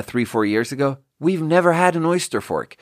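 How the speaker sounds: ordinary talk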